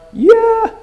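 "Yeah" called out very high; one sudden high-pitched, emphatic "Yeah". Acted. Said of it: "it's emphatic".

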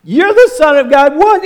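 overwhelmed half-laughing shock; freaking out. Retold or imitated. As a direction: disciples losing it, yelping in awe. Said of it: "coming apart at the seams".

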